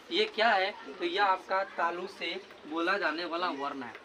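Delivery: ordinary delivery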